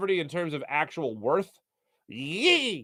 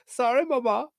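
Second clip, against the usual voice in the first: put-on much higher, sing-song little-boy apology; sheepish apology; 9 semitones higher than usual. Husband apologizing to his wife in a high sing-song voice. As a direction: squeak it like a scolded kid. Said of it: "I'm like".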